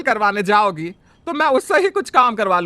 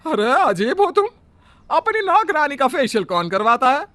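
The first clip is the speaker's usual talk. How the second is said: higher, louder indignant exclamation; exasperated disbelief; 6 semitones higher, 4 dB louder than usual. Acted. Mother-in-law scolding the bride over maid's facial, disbelieving. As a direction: throw up your hands at her.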